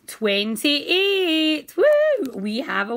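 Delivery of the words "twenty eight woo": loud, high whoop of delight; excited cheer. Real, not acted. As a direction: celebrate the win out loud. Finds winning number.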